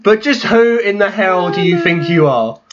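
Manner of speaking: slow, smarmy excuse me; imitating Heyman's smug promo; Paul Heyman impression. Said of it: "Paul Heyman comes out".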